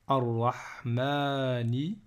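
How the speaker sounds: "Arrahmani" very slowly, lingering on "maa"; slow "Arrahmani" with doubled r and long a; long alif pronounced. Shown as a demonstration. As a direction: draw out the a after the m in "Arrahmani". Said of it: "il faut quand même le prononcer".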